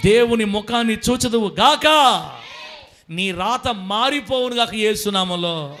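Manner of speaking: rising to a slow, loud proclaimed blessing; preaching blessing. Acted. Preacher blessing the congregation, building to a shout. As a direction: build from steady to a shouted benediction.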